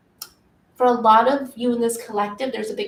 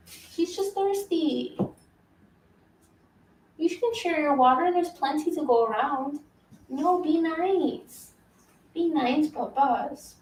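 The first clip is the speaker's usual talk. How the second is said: gentle pet talk; 5 semitones higher, slower than usual. Real, not acted. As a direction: sweet-talk the cat into sharing. Coaxing her pets gently.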